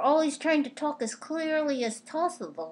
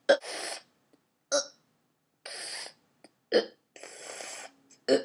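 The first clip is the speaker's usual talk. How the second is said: hiccups; much faster than usual. Real, not acted. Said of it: "I've never heard you with the hiccups".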